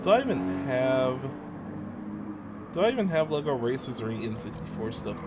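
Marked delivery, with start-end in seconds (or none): none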